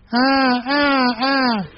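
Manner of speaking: high, strained mock bray; a human stab at a donkey's hee-haw. Preacher imitating a donkey stuck in a well. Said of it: "I can't really do donkey sound".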